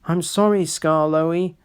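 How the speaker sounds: ordinary delivery, nothing marked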